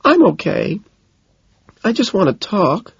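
very high, acted plea; begging. A narrator's reading. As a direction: plead for a chance to talk. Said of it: "she begs".

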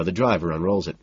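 plain reading voice

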